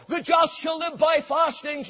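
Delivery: shouted, high; rejected verse. Retold or imitated. Preacher shouting the wrong verse Luther tried. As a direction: fling it out then refute it. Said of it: "that word darted into his soul".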